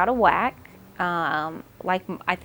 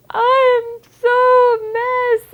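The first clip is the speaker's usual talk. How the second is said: high, loud, slow wail, voice breaking; retold crying; 11 dB louder, much slower than usual. New mom answering the doctor, wailing in tears. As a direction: wail it like tears are spilling over. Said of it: "And I just start crying".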